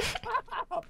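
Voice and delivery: high squealed stop; squealing protest. Real, not acted. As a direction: squeal it while laughing. Friend teasing, protest.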